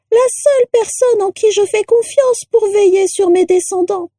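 put-on very high, shrill old portrait; rattle-voiced portrait. A narrator's reading. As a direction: confide gratefully in a piercing, reedy voice. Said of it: "une voix de crécelle".

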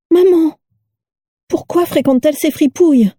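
high-pitched startled question to mother; startled girl. A narrator's reading. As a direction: cry out in high, startled disbelief. Daisy startled by the news. (fille stupéfaite, voix haut perchée)

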